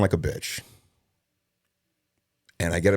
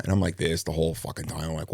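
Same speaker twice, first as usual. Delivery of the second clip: usual speaking voice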